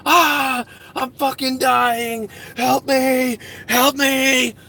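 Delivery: hammed-up high cry for help, slow and drawn out; mock screaming. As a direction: pretend to be dying and call for help. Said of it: "they could hear me out here screaming like".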